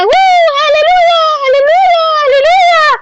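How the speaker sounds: high, loud angelic cry of joy; joyful shout. Retold or imitated. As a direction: shout hallelujah like rejoicing angels. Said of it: "Sisigaw na sila".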